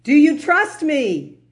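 lightly played God asking calmly; God tests David's faith from above; God's voice. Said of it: "He says".

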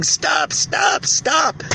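repeated high-pitched pleas, held back in volume; imitated screaming child. Child begging father to stop, imitated screaming. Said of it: "the child screaming".

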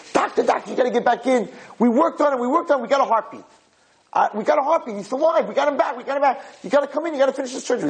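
very high, urgent imitation of a frantic nurse; screaming nurse. Nurse calls the doctor back into surgery, frantic high cries. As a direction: cry out breathlessly urgent, pitch way up, no real scream. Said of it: "this nurse comes screaming out".

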